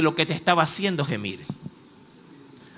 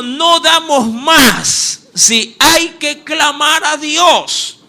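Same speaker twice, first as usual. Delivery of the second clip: lamento del pueblo; 7 semitones higher, 6 dB louder, much slower than usual. Acted. El pueblo clama a Dios.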